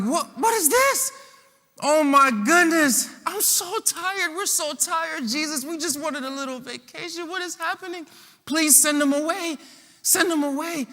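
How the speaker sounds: whiny tired disciples. Acted. Exhausted disciples whining to Jesus to send the crowd away. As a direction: moan like worn-out guys whose vacation got ruined.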